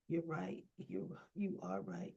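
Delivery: softly conceding, retold; quiet concession. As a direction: drop the voice to act out giving in. Acted quote, conceding after a dispute.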